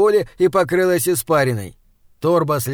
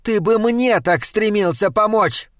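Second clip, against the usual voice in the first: bitter jab; 6 semitones higher, 3 dB louder than usual. A narrator's reading. Jealous wife snaps.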